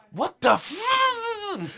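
high, very slow, cut-off outrage; mock outrage. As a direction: stretch the disbelief out. Reenacting anger after spit.

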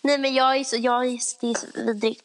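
ordinary talk, nothing marked

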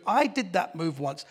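normal talking voice

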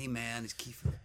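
low, soft American star voice; Kiefer impression. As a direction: murmur it low like a laid-back film star.

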